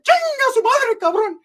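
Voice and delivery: very high falsetto Spanish cursing; squeaky Spanish curse. Retold or imitated. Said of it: "They're like".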